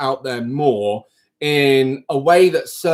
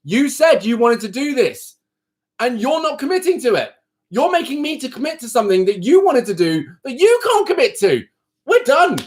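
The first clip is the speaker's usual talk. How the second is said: fed-up rant at clients who won't commit, climbing higher; frustrated rant; 8 semitones higher than usual. Real, not acted. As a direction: let the frustration climb until you snap. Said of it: "it's so frustrating".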